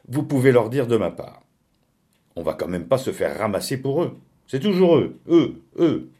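loud, high-pitched indignant outburst; exasperated refusal. Acted. Lucienne refusing to shelter the Silmans, exasperated.

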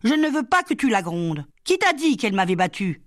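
put-on high-pitched indignant little girl; angry child's cry. A narrator's reading. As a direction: protest crossly as a small child. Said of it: "puis elle cria, tout en colère".

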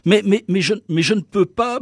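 high-pitched, flustered stammer. A narrator's reading. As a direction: stumble and restart, as if cornered by a superior. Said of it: "balbutia l'escarbot". (balbutiement aigu et embarrassé)

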